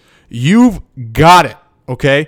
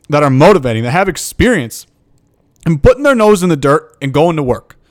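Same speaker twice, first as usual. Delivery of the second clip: ordinary talk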